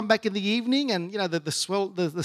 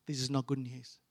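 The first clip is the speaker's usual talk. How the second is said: low, soft retold warning; hushed warning; 5 semitones lower, 10 dB quieter than usual. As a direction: break it gently and low to mum. Warning his mum about doctor.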